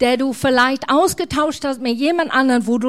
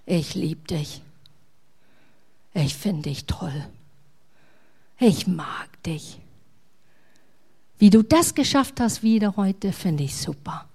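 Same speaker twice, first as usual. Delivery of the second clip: whispered tenderness; 3 semitones lower, 4 dB quieter than usual. Acted. God's tender words to listener.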